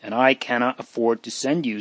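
plain reading voice

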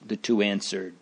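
usual speaking voice

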